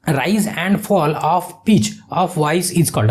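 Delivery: English question read out very slowly, word by word; slow dictation. Shown as a demonstration. Teacher reads the next question aloud, very slow. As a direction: read it slowly so students can follow.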